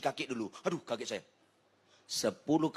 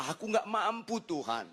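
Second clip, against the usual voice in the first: retold plea; 7 semitones higher than usual. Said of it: "saya bilang sama Tuhan".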